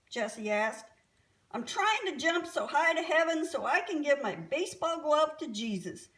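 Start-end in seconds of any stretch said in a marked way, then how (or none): 1.5-6.1 put-on higher, louder, excited kid explanation; eager boy. A narrator's reading. Boy tells his neighbour about jumping to heaven, excited. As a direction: blurt out the big plan excitedly.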